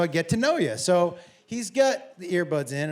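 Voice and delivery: ordinary delivery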